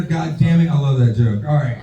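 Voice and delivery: normal talking voice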